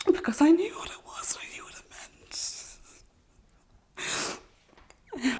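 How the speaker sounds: tearful whisper, voice cracking high; apologising through real tears; crying. Real, not acted.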